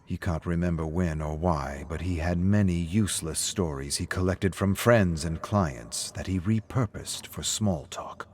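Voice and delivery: plain reading voice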